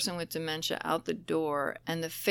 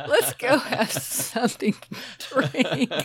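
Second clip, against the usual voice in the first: laughing; 8 semitones higher, 5 dB louder than usual. Real, not acted. Laughing, recalling the toast.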